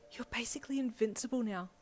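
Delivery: high-voiced, awed fangirl; awed co-worker. A narrator's reading. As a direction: marvel in a high, timid voice.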